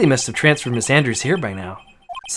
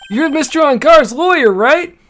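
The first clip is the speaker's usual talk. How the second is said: high, raised detective voice; calling across room; 12 semitones higher, 5 dB louder than usual. Acted. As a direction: holler to someone standing far away. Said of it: "I have to yell at you".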